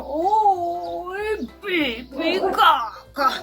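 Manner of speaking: high, drawn-out bunny voice; high, very slow cartoon bunny; little bunny. A narrator's reading. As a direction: stretch it out in a high little-rabbit voice. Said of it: "Y él decía".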